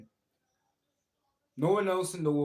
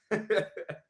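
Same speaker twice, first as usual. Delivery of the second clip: giggle; 8 semitones higher, 4 dB louder than usual. Real, not acted. Laughing at own impression.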